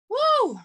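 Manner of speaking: high whooping woo; bright excited whoop. Real, not acted. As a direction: let out a loud joyful whoop. Breath exercise exclamation.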